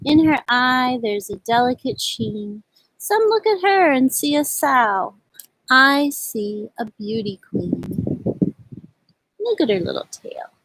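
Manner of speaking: gentle, unhurried read-aloud poem voice; slow, tender picture-book reading; reading to children. Acted. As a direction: read it like storytime for little kids. Reads picture-book poems to children.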